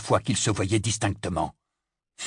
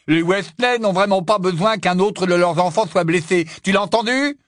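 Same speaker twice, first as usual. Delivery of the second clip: high, loud indignant outburst; angry reproach; 9 semitones higher, 6 dB louder than usual. A narrator's reading. Ron rebukes Harry over his hurt family, angry. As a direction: throw the accusation at him, high and loud. Acted quarrel between friends.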